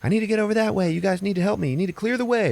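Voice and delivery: pushy Abby. Retold or imitated. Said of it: "Abby, is like".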